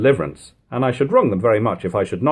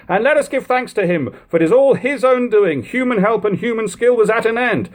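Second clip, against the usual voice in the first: high, fervent thanks to God; thankful stranger; 11 semitones higher than usual. A narrator's reading. Third man urges thanks to God, high and fervent. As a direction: proclaim the deliverance with grateful awe. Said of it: "expressing their surprise".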